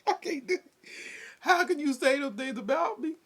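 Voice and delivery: high, whiny pretend sob; mock crying. Imitating the crying sister who wants support, high whine. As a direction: whimper it like a wounded sister. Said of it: "she just started crying".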